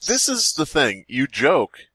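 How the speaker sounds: ordinary talk, nothing marked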